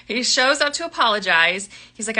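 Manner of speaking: normal talking voice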